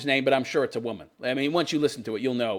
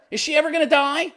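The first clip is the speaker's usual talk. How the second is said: normal talking voice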